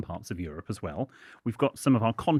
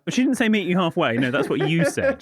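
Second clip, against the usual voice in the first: loud teasing; 8 semitones higher, 7 dB louder than usual. Real, not acted. Teasing correction.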